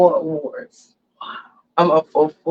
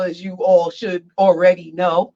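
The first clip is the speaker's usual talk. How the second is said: ordinary talk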